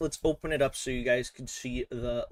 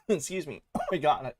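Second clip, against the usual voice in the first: ordinary talk, nothing marked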